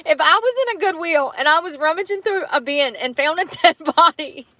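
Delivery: can't stop laughing as you explain; real giggles breaking into the words; laughing while talking. Real, not acted. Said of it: "I'm laughing because".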